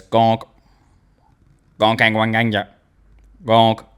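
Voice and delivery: low flat droid honks; low mock gonk sounds; droid gonk noises. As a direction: imitate the gonk droid lightly. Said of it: "a droid that walks around and goes".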